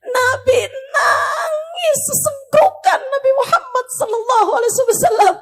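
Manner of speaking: high, loud, choked-up preaching; tearful narration. A narrator's reading. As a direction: let the story break into a cry. Narrates the Prophet sobbing. (bercerita dengan suara tangis)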